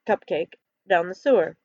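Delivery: usual speaking voice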